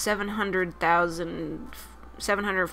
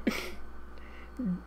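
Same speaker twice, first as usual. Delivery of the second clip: soft, breathy laugh; 10 dB quieter than usual. Real, not acted. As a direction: laugh quietly at yourself. Laughs at own accent attempt.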